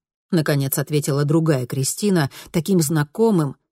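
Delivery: ordinary delivery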